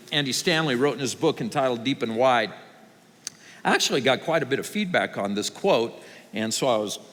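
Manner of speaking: ordinary talk, nothing marked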